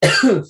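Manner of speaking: loud choking cough; hacking, struggling for air; coughing up water. Real, not acted. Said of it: "Just went down the wrong way".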